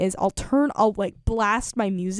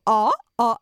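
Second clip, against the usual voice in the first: short ah sounds, a bit louder; vocal noise demo; 4 dB louder than usual. Shown as a demonstration. DJ imitating the songs she likes. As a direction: mimic the noisy singing once more. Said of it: "Okay, I'm gonna stop doing that".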